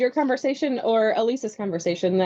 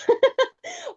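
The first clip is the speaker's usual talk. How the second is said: laugh. Real, not acted. Joke about not passed away.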